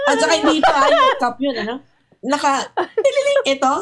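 words pitched high through real laughter; laughing. Real, not acted. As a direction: giggle through the teacup description. Laughing while describing teacups.

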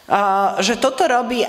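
normal talking voice

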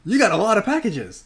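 high, loud mock clerk's surprise; surprised clerk. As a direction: imitate the amazed postal worker. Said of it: "the post office person there is like".

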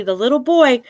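ordinary delivery

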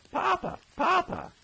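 put-on very high child's shout; little boy hollering for his papa. A narrator's reading. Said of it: "that young one hollers".

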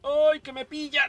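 high, loud, slow panicked ay; panicked cry. Real, not acted. Player panics as the plant nearly catches him, high and loud. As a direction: cry out as it grabs you.

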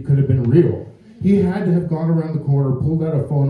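ordinary talk, nothing marked

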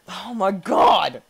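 slow, loud oh my god; real alarm stretched out; loud drawn-out shock. Real, not acted. Player watching his rider get speared, loudly shocked. Spear level going wrong.

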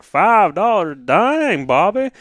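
high, drawn-out mock American drawl; mock American accent. American listener reacts to beer price, exaggerated American drawl. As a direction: stretch it out in a put-on American accent. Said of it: "American listeners are gonna be like".

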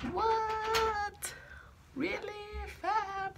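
high-pitched mock disbelief; imagined viewers' shock. Vlogger imagining viewers hearing her troubles, mock shocked squeal. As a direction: squeal it like stunned fans. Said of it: "you guys would be like".

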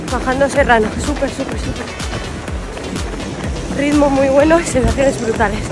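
breathless, loud, mid-run; talking while running hard. Real, not acted. Grabándose mientras corre. (hablando mientras corre)

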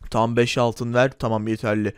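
low, bossy shopkeeper voice; gruff shopkeeper. Acted. Shopkeeper Erdal Bakkal teasing the customer, low gruff voice. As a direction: play the pushy corner-shop owner, pitched low.

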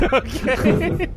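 high, squeaky dazed "okay"; mock dazed reply. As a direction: imitate a stunned friend. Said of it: "your face just says".

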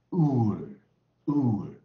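"ur" held long and loud into a curled R; American R-coloured vowel. Shown as a demonstration. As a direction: demonstrate "ur" twice, each falling into a clear R. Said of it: "我就念什么". (美式 "ur"，拉长卷舌)

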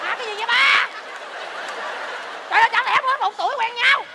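high laughing question; laughing disbelief. Acted. Mocking the affair's timeline, high laughing disbelief.

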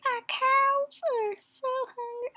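put-on high, whiny old farm girl voice; lame farm girl impression. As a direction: plead like a wee old farm lady. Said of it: "my lame impression".

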